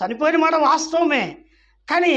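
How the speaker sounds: loud, high concession; emphatic preaching. Real, not acted. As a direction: hit it hard before the turn.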